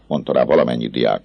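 usual speaking voice

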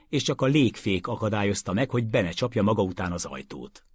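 ordinary delivery

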